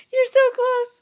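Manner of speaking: excited encouragement. Real, not acted. Mother cheering on her baby.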